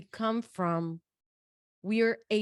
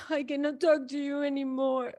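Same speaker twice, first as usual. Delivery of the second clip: retold exasperated outburst; 5 semitones higher than usual. Hanging up on the seller.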